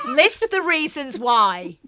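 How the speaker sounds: loud, high laughing yell, drawn out; mock shouted demand. Interviewer jokingly demands reasons from guest, mock shout. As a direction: shout it playfully through laughter.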